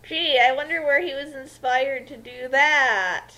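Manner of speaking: ordinary talk, nothing marked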